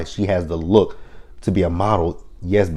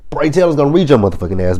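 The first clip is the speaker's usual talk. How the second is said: faster, higher, louder warning; worked-up fast rant; heated rant; 7 semitones higher, 4 dB louder, faster than usual. Real, not acted. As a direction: rattle it off all fired up. Her own rant at Candy.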